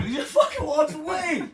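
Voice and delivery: outraged punchline; incredulous. Real, not acted. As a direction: wail it in comic exasperation. Repeats incredulous complaint.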